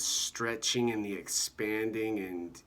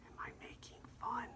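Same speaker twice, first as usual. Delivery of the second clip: breathy high whisper, fast; 14 dB quieter, much faster than usual. Shown as a demonstration. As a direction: whisper it like a guilty aside. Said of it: "you start to, you know, whisper".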